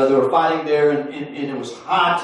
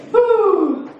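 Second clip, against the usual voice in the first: excited whoop; 4 dB louder than usual. Real, not acted. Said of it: "preacher reacts to joke".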